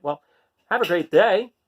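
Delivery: ordinary delivery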